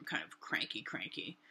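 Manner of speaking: low, soft cranky mumble; mock grumbling. Stamping off to the bathroom, mock grumbling. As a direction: grumble it away under the breath.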